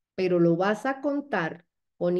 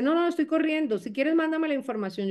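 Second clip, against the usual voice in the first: rushed prospect; 8 semitones higher than usual. Acted. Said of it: "Si me dice".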